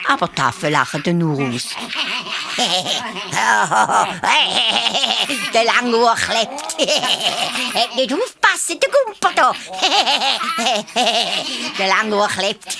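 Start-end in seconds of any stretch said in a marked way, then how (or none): 1.7-5.5 high mocking monkey cackle; monkey laughter. A narrator's reading. Monkeys jeer at the stuck hare with high cackles. 5.5-12.8 high, laughing monkey taunt; mocking monkeys. A narrator's reading. Monkeys taunt the stuck hare, high and laughing.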